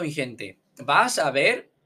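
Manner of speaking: usual speaking voice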